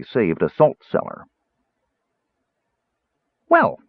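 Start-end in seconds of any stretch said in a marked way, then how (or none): 3.5-3.8 high bright kender exclamation; eager high "Well". Acted. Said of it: "said Tass brightly".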